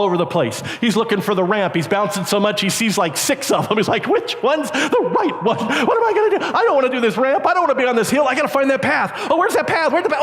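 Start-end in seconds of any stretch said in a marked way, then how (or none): 4.1-10.2 high-pitched frantic babble; mock panic. Bouncing rider hunting for the ramp, high panicked yelling. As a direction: fret aloud in comic terror. [name] acted panicking on bike.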